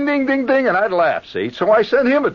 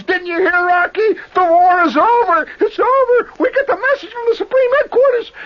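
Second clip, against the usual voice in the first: excited shout; 13 semitones higher than usual. Retold or imitated. Soldier tells Aki the war is over, high and thrilled. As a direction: blurt out the big news. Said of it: "the guy says".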